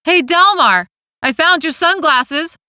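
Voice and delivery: high-pitched call out the window; yelled. A narrator's reading. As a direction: call out to someone across the way, voice lifted. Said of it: "stuck his head out the open window and yelled".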